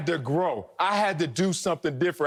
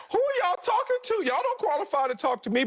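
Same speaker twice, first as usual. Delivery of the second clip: high-pitched mock outrage; indignant retort; 13 semitones higher than usual. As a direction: fire back at doubters, pitched way up. Imagined indignant retort to the doubters.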